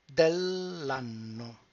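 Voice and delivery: "dell'anno" slow, with the double n held; slow, syllable by syllable. Shown as a demonstration. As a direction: hold the double n in "dell'anno" slowly. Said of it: "break down the word".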